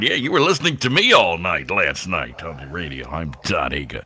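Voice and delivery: singsong radio jock impression; imitated self-important DJ; Todd Hager. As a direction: roll it out like an on-air sign-off. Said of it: "He'd start talking".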